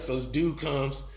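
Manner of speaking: plain reading voice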